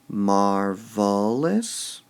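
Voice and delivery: Marvelous drawn out very slowly, rising; slow stretched Marvelous. Shown as a demonstration. Teacher drags out Marvelous for the class. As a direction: stretch Marvelous word-long with MAR leaned on. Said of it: "We emphasize the mar part".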